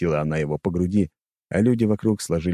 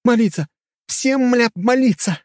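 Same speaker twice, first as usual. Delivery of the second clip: high, loud frantic command; high-pitched desperate shouting; urgent shouted order; 5 dB louder than usual. A narrator's reading. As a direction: yell at the whole crowd to obey. Heroine ordering crowd to pray.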